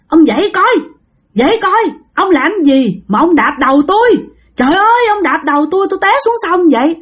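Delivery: high-pitched grandmother berating her husband; indignant, high-voiced telling-off; angry scolding. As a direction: scold him like an outraged wife, pitched well up. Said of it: "bà ngoại mới chửi nha".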